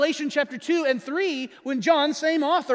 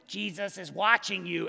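low, inhuman talking-bird voice; eerie parrot voice; 5 semitones lower, 3 dB quieter than usual. Acted. Parrot in the dark warning the robber, low and eerie. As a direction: intone it like an unseen parrot spooking a burglar. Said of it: "It doesn't even sound human".

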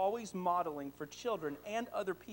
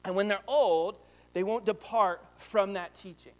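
loud, slowed, weighty reading; proclaiming the promise; emphatic scripture; 7 dB louder than usual. Shown as a demonstration. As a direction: drive the verse home. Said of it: "verse six says".

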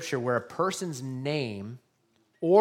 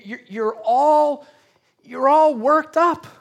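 higher, louder, slow emphasis; mock worked-up; 9 semitones higher, 10 dB louder, slower than usual. Preacher mirroring Martha's stress, loud and high. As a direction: act out her agitation. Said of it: "with compassion in his voice".

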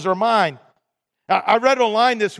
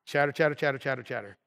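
chatter, chatter said much softer, trailing off; fading chatter; 7 semitones lower, 10 dB quieter than usual. Acted. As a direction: mutter it like background noise. Mimicking chatter again.

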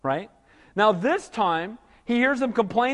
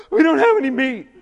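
a put-on whiny complainer's voice, higher; mock whining; 4 semitones higher, 6 dB louder than usual. Israelites whine to Moses about meat, mock whiny. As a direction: whine it like a spoiled churchgoer. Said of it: "Whining and complaining".

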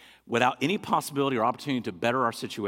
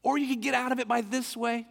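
imitating a voice in one's head; inner tempter voice; 8 semitones higher than usual. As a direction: play the inner voice nudging toward an excuse. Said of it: "there's that inside that goes".

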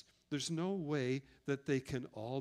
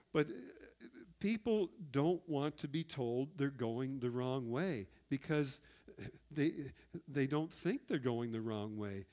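ordinary talk

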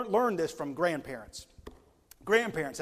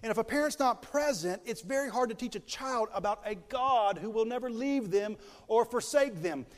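normal talking voice

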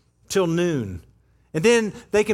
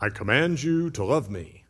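a low, softer mock decree; mock commanding; 9 semitones lower, 3 dB quieter than usual. Preacher mocking a commanding god, low and mock stern. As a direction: play a tyrant demanding love, half-joking.

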